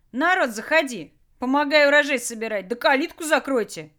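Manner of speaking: high, loud cheerful holler; calling out. Acted. Jack calling toward the broken door, shouting. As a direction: call the gang in from outside. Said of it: "Крикнул в сторону выломанной двери".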